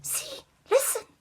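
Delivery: high hushed whisper, mostly unvoiced; suspicious whisper. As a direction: whisper it while listening hard. Queen Fairy suspicious, listening.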